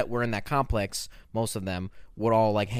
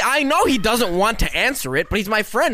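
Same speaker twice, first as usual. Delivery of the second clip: loud; 11 semitones higher, 5 dB louder than usual. Acted. Mock outrage at dodged question.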